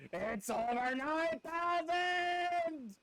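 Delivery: Vegeta shout. Pretend. Quoting the Vegeta meme.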